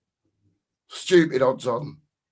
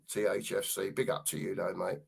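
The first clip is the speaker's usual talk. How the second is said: tired throat; 8 semitones lower, 12 dB quieter than usual. Real, not acted. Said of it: "The old throat's getting old".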